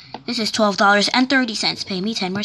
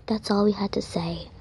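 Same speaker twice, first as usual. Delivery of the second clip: soft unvoiced whisper; 7 dB quieter than usual. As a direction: whisper the sign-off. Whispered sign-off.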